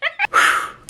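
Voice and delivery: airy squealed laugh; real giggle; breathy high laugh. Real, not acted. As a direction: let out a giddy laugh. Laughing after outburst.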